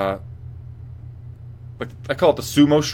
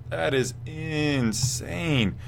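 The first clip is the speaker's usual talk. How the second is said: normal talking voice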